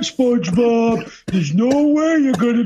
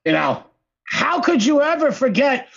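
ordinary talk

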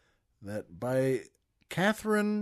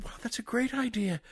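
excited; 6 semitones higher, 5 dB quieter than usual. Acted. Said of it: "is excited".

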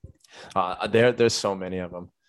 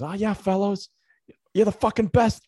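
very high-pitched retold heckle; mock bench chirp; 12 semitones higher, 4 dB louder than usual. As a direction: imitate a player jeering from the bench, pitched way up. Imitating player heckling from the bench.

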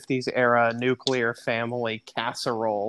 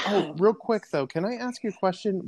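higher-pitched pretend customer with a question; mock polite curiosity; 7 semitones higher than usual. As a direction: play a tentative diner interrupting the server. Acting a curious restaurant customer.

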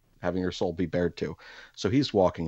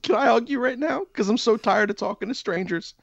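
much higher, pleading rush of relief; hammed-up desperate relief, pitched way up; relieved gush; 3 dB louder than usual. Relieved plea to stranger.

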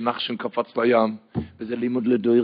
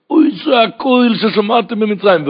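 loud, high-pitched impassioned sermon; preaching at full force; 9 semitones higher, 10 dB louder than usual. Acted. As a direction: drive the verses home at the top of your voice. (דרשה נלהבת בקול רם)

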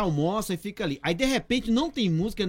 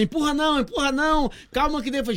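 higher-pitched announcer calming a crowd; mock crowd-control call; 8 semitones higher than usual. Vendor on the truck pretending a crowd is pushing, high announcer call. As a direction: call out as if holding back a rush. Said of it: "o dia da Bela assim".

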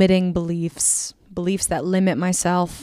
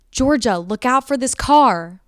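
higher, louder imitation of a warning call; retold urgent warning; 5 semitones higher, 7 dB louder than usual. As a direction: call it out like a sibling spotting a car. Retells sibling's urgent warning.

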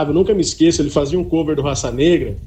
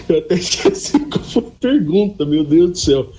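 rindo enquanto fala; laughing. Real, not acted. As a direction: palavras ainda entre risadas. Rindo depois da piada do empresário. (falando entre risos)

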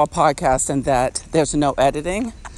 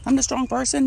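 high, lightly mocking inner voice; mock self-quote; 9 semitones higher, 3 dB quieter than usual. Podcaster quoting her old thinking, high mock self-quote. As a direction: pitch it up, quoting your deluded past self. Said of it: "because I was thinking".

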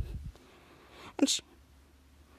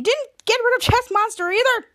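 high-pitched incredulous scolding; outraged disbelief; 8 semitones higher, 3 dB louder than usual. Acted. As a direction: blurt it out in mock outrage. Acted outraged reaction to confession.

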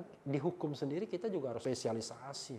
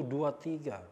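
ordinary delivery

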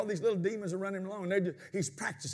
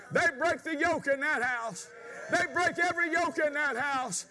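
loud, higher, shouting preacher in full flow; full-force preaching; 9 semitones higher, 3 dB louder than usual. Acted. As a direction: preach it out to the congregation.

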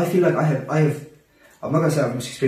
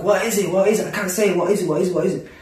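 higher-pitched, frantic repeated questioning; mild mock distress, urgently repeating; retold crying; 5 semitones higher than usual. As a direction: re-enact a tearful struggle to remember. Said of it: "And I'm crying".

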